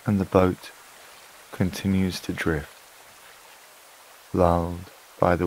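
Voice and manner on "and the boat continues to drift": usual speaking voice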